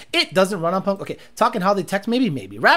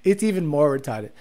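ordinary talk, nothing marked

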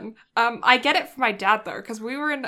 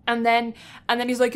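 usual speaking voice

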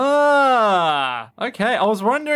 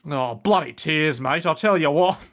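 lightly put-on Australian accent, cheerful; playful Australian slang voice; mock Australian; 2 semitones lower than usual. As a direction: toss off an Australian bloke's curse. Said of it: "sounds like an Australian faux curse word".

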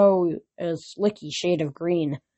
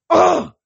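loud high exasperated ugh; frustrated groan; 11 semitones higher, 9 dB louder than usual. A narrator's reading. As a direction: let out a furious grunt. Thalia's frustrated outburst.

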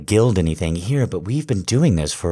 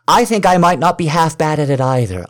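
higher and louder, eager; excited insistence; 7 semitones higher, 4 dB louder than usual. Acted. To partner, repeating the hope with excited higher louder insistence. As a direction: push it with rising excitement.

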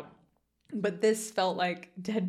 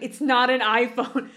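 slow, louder exasperated retort; mock rage; 3 semitones higher, 7 dB louder than usual. Daughter correcting her mother at the table, mock rage. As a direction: imitate a daughter barely holding in rage. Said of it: "You can feel the rage".

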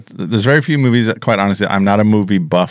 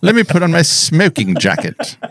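higher, lordly English gentleman voice; posh English gentleman; 8 semitones higher than usual. Pretend. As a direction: play the stuffy English gent. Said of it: "became an English gentleman".

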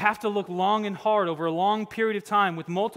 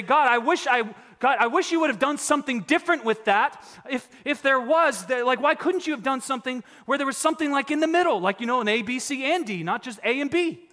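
higher, louder mock-whiny plea to God; mock pastor's complaint to God; 8 semitones higher, 7 dB louder than usual. Preacher quotes pastors who question God, in a hammed-up whiny plea. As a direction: ham up a pastor griping at God's design. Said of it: "I'm quoting here".

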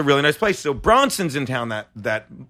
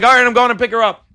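excited; 5 semitones higher, 5 dB louder, faster than usual. Retold or imitated. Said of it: "He's like".

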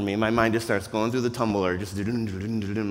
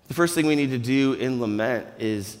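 ordinary talk, nothing marked

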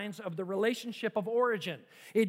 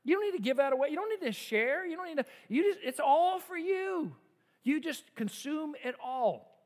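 voice of greed; 6 semitones higher than usual. Acted. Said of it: "Greed says".

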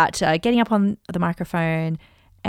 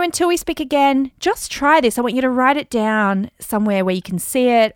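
ordinary talk

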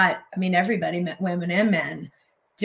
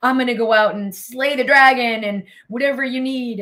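mock heroic bravado; 5 semitones higher, 6 dB louder than usual. Restored man voiced, heroic.

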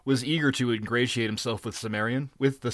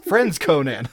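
high, loud, placating pirate; loud, fawning protest of comradeship; eager appeal; 6 semitones higher, 7 dB louder than usual. A narrator's reading. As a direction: win the captain over eagerly. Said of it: "they cried eagerly".

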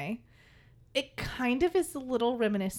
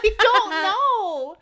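loud, high, drawn-out exasperation; mock exasperation; 10 semitones higher, 11 dB louder, much slower than usual. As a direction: drag it out, comically fed up. Retold exasperation with the kid.

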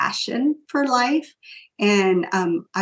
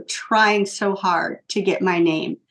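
ordinary talk

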